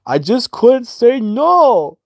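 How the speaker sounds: put-on very high, slow baby voice; mocking childish voice; babyish whine. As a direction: whine it like a toddler. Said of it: "He tells Debbie".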